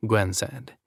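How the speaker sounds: usual speaking voice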